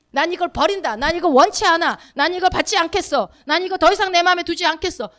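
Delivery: determined renunciation; firm rejection. Shown as a demonstration. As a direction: refuse it forcefully line by line. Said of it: "마음대로 하셔도 돼요".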